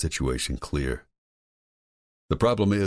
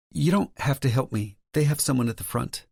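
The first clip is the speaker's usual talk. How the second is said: higher, lightly voiced woman; female character voice; 11 semitones higher than usual. A narrator's reading. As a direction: play Lauren, higher pitch.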